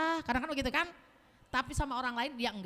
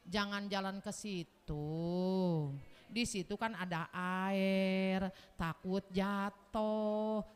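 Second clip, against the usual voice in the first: tender warning; 5 semitones lower, much slower than usual. Retold or imitated. Husband tenderly warning widow not to slip. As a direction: gently warn her like a loving husband.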